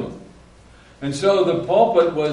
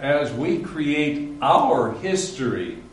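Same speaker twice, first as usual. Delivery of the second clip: normal talking voice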